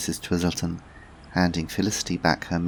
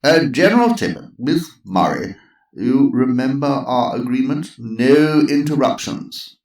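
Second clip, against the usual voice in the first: higher, louder posh chairman; calm the room firmly; Sir Lancelot; 7 semitones higher, 8 dB louder than usual. Acted.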